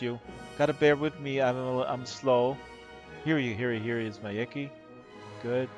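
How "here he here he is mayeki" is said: ordinary talk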